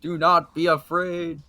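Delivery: high, loud proclamation of a priest; exorcising priest. Acted. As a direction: cry out like a priest casting out demons.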